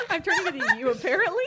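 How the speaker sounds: words through high loud laughter; laughing. Real, not acted. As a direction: talk while cracking up.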